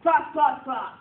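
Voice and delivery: loud repeated call; teacher's repeated call for attention. Shown as a demonstration. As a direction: call it out twice to the room. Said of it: "let's practice our class yes".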